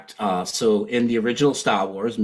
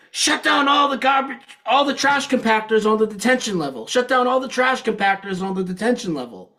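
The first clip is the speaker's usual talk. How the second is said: high-pitched mock movie shout; imitated yelling; 11 semitones higher than usual. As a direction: cry it out high, like a panicked radio order. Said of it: "He yells".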